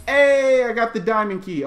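high, loud burst of triumph; excited cheer. Real, not acted. Gamer cheering at finding the diamond key, excited. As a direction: crow loudly over a lucky find. Finds key while playing.

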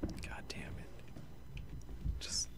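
hushed swear; whispered curse. Real, not acted. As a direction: curse under your breath. Frustrated, hiding.

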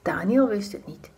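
ordinary delivery, nothing marked

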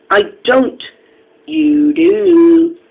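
loud, high back-and-forth retort; escalating squabble. A narrator's reading. Engines quarrelling over telling tales, loud and high squabble. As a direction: raise pitch and volume, dragging out the comeback.

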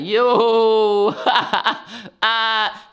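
high, loud laughing yo. Retold or imitated. Teen partying loud in his room, laughing shout. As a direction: whoop it up with a laugh. Mocking himself partying loudly.

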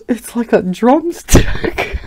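retelling the punchline while cracking up; laughing quote. Retold or imitated. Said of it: "I laughed for five minutes straight".

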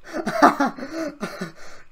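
high laugh. Real, not acted. After the clip kill.

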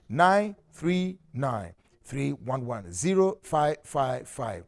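slow number dictation. Acted. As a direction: read each digit out for listeners to note. Host dictating phone numbers.

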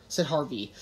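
usual speaking voice

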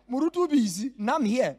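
loud, laughing mock of a proud stand-in; mocking arrogant delegate. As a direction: ham up the self-important delegate. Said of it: "That's how it sounds".

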